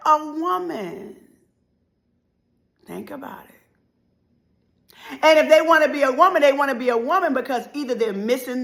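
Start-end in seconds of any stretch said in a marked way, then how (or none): 2.8-5.2 slow breathy whisper; whispered aside. Real, not acted. Preacher leaning in to the congregation, whispering. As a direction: lean in and whisper it.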